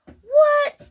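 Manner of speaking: a shrieked, very high and loud what; shocked what. Retold or imitated. Hearing the spirits crowd in, a shocked high shriek. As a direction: squeal it in disbelief. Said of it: "I'm like".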